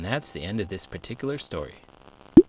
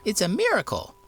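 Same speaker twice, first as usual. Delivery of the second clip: much higher, louder, overjoyed; amazed cry; 14 semitones higher, 7 dB louder than usual. A narrator's reading. Jasper marvels at the talking coal, high and thrilled. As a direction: cry out in wonder. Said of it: "cried Jasper".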